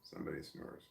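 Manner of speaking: hushed whisper so the sleeping dog isn't woken; barely breathed, almost no voice. Real, not acted. Quiet while the dog sleeps.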